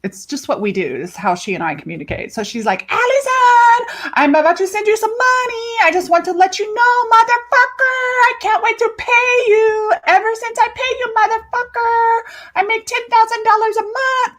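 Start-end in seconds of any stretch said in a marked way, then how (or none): 2.9-14.4 very high, hollered singsong impression; client's playful voice. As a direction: imitate an excited client crowing her news into an audio message. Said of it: "I'm gonna do her voice for you".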